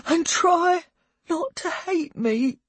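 tearful mother. A narrator's reading. Mother begging her son to go to foster care, tearful. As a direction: plead through tears, in halting phrases.

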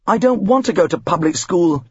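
plain reading voice

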